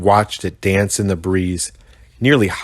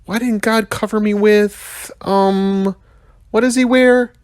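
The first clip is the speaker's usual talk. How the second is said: high put-on young rhino voice, searching for a word; whiny kid rhino groping for the word; Mac the rhino muttering; 13 semitones higher than usual. A narrator's reading. Rhino complaining to the narrator, high and whiny. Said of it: "Mac mumbled".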